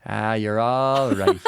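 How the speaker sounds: slow, drawn-out Irish "all right"; easygoing mock-Irish reassurance, rising at the end; laid-back Irishman. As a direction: wave it away in an Irish lilt. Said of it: "the Irishman's just in there like".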